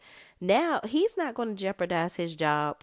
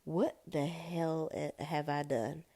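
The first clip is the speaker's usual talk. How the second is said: slow, softer dawning realization; contemplating; 5 dB quieter, slower than usual. Retold or imitated. Danny sitting there after the trouble, contemplating. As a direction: draw it out softly, as if to yourself.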